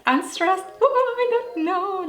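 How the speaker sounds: high-pitched flustered squeal; mock despair, voice shooting way up; comic panic. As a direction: squeal helplessly as if totally lost. Acts out panicking over pronunciation.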